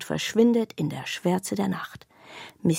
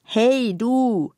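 a lightly raised, louder call from a toy bag; voiced talking bag; 4 semitones higher, 4 dB louder than usual. A narrator's reading. Chatty bag calling to the intruder, eager. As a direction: hail her from the bed, perky. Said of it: "sagt die Tasche nochmal".

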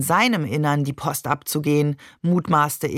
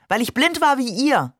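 higher, mildly heated retort; heated insistence; 6 semitones higher than usual. A narrator's reading. Father defends his conversion to his family, heated. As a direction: push the point home, worked up.

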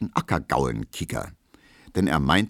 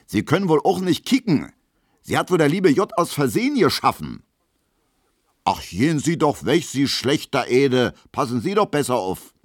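high-pitched, loud Berlin tirade; angry scolding; 8 semitones higher, 4 dB louder than usual. Acted. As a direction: scold the clumsy pair in a raised voice. Dancer berates couple after collision. (aufgebracht geschimpft)

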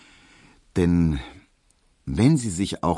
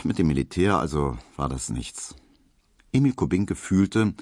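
usual speaking voice

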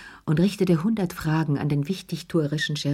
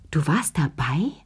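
hushed, breathless question; stunned whisper. Acted. Lea stops laughing, whispers her shock to Chérie. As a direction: whisper it in disbelief. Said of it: "Sie hörte auf zu lachen".

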